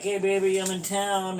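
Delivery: imitating wife. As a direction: chirp it like a cheerful wife. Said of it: "she'll be like".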